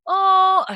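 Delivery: high, loud pretend delight; put-on gift-opening reaction; mock gushing "oh". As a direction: fake a big, high coo over a present. Mock reactions to shower gifts.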